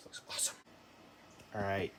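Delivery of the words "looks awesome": whispering. Real, not acted. Awed over new engine.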